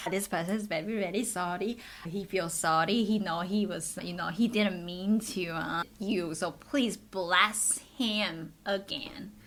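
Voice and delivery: put-on Taiwanese-accented pleading to a god. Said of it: "Taiwanese accent activated".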